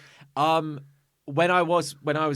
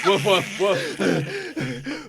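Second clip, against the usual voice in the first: barking dog; 6 semitones higher, 3 dB louder, much slower than usual. Acted. Dog imitation.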